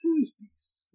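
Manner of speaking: high quiet mock Italian accent; lightly hammed Italian accent. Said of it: "Italian accent".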